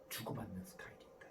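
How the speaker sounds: ordinary delivery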